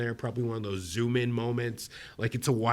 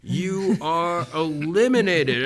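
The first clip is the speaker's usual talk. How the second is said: mock elimination announcement; 6 semitones higher, 4 dB louder than usual. Host acting out the elimination announcement.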